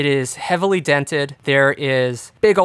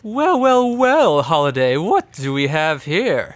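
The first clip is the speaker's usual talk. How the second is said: villainous man; slower than usual. Acted. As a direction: toy with them like a smug villain. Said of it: "this man, he's throwing a small shiny object".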